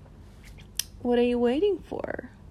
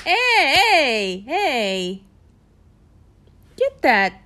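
playful warning; 3 semitones higher, 8 dB louder, slower than usual. Acted. Warning the listener off her, playful loud calls. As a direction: call him out, half laughing.

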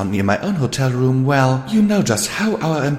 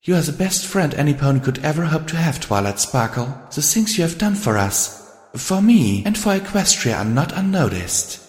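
higher-pitched, earnest praise; poised, emphatic character voice; confident; 8 semitones higher than usual. A narrator's reading. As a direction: assure a friend with heartfelt conviction. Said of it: "she said confidently".

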